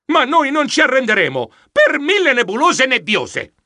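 high, determined exclamation; rally the crew with fierce resolve; defiant outburst. Acted. Defiant exclamation after bad news.

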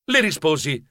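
plain reading voice

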